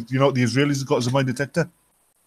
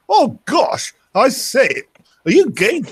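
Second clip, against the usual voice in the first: high, loud toff voice; upper-class English idiot; 11 semitones higher, 5 dB louder, slower than usual. Acted. Hunter hails naked woman in the bush, hammed-up upper-class English idiot. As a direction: hoot it like a daft aristocrat. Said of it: "upper-class English idiot out".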